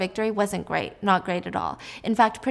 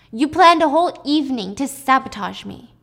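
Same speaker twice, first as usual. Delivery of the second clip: louder, higher accusing outburst; angry accusation; 4 semitones higher, 8 dB louder than usual. Acted. Husband accusing Alice after the dinner, angry. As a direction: accuse her in a raised voice.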